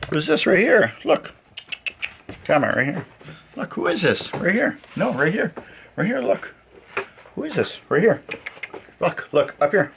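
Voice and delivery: high squeaky coaxing toward camera; talking to a dog. Real, not acted. Getting dog to look.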